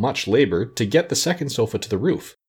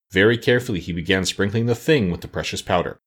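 ordinary delivery, nothing marked

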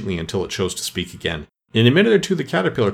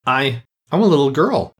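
hesitant, higher-pitched answer; rather doubtful; 4 semitones higher, 4 dB louder than usual. A narrator's reading. Alice tells the pigeon what she is, doubtfully. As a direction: stumble into it, unsure of yourself. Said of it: "said Alice rather doubtfully".

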